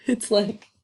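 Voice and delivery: ordinary talk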